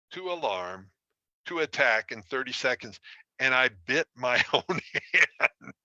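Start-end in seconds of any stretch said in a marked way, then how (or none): 3.9-5.7 slow down as the laugh takes over; amused at your own silliness. Real, not acted. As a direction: laugh through the punchline. Laughing at own embarrassing story.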